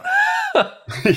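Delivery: sudden squeaky laugh; high giggling laugh; high burst of laughter. Real, not acted. Laugh after creepy shot.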